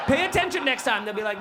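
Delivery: ordinary delivery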